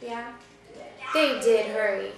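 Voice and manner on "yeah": normal talking voice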